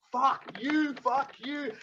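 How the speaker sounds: high-pitched retold cursing, held back in volume; exploding in anger. Punching his mate over and over, retold angry cursing. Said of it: "I fucking explode".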